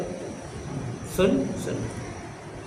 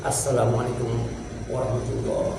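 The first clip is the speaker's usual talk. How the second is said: exhausted salam; 6 semitones lower, 8 dB quieter than usual. Retold or imitated. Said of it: "capek banget".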